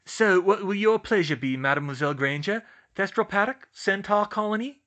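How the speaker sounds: hammed-up French waiter, high and loud; loud mock French accent. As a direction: play a French host offering the menu. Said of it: "He affected a French accent".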